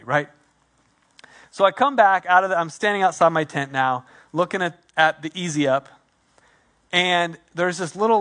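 normal talking voice

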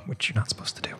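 hushed, near-whispered aside; secretive aside. Pretend. Guide admitting a secret museum tour, secretive whispered aside. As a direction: drop to a whisper, as if confessing a secret.

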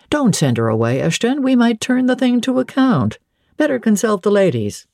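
a hint of an eager colonel; interrupting colonel. A narrator's reading. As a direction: lightly play a colonel cutting in. Colonel Dent interrupts with a proposal.

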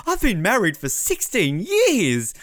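high, slow, overly sentimental imitation; high and drawn-out, mock tearful; mock emotional Nicole. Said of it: "I love how she's like".

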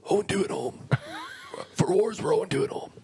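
Malcolm Marius impression. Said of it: "That's Malcolm Marius".

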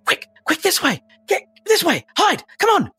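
high, strained urgent calls; urgent voices. Acted. Voices outside call others to hide, urgent. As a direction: hurry people along in high bursts. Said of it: "you hear voices saying".

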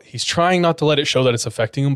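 usual speaking voice

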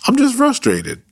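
high-pitched playful declaration; playful squeak. Shown as a demonstration. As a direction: squeak it out with a grin. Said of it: "Let's declare".

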